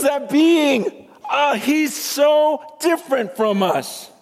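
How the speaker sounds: overwhelmed with awe, pushing it up high; an angel before the throne, high and awed; awestruck angel. Acted. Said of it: "He goes".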